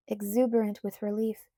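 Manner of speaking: ordinary delivery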